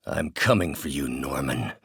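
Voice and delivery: very low villain menace; menacing threat. Acted. Villain threatens his enemy.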